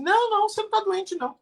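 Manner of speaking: negação aguda e apressada, encenada; negação enfática. Acted. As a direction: encenar alguém negando depressa, em tom agudo. Alguém nega a doença do outro. (negação insistente e apressada)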